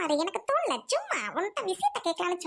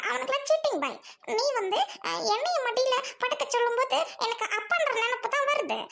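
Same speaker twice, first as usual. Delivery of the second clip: ordinary delivery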